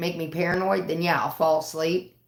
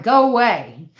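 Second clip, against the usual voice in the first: loud, higher grumpy "go away"; mad retort; 4 semitones higher, 7 dB louder, slower than usual. Retold or imitated. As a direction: shoo them off, mock annoyed. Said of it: "And I'm like".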